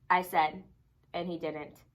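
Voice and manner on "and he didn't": usual speaking voice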